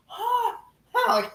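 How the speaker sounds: very high "ha" gasps; exaggerated squeaky huffs of shock; mock shocked gasp. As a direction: imitate an affronted intake, twice. Repeats the mocked dying reaction.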